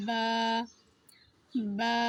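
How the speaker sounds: বা with both lips touching lightly. Shown as a demonstration. Teacher showing a light-lipped বা. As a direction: barely close the lips on বা. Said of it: "দুই ঠোঁটের সাথে হালকা করে লাগিয়ে".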